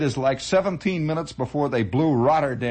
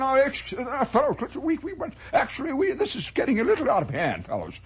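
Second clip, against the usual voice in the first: calming Englishmen; 8 semitones higher than usual. Retold or imitated. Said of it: "trying to calm everybody down".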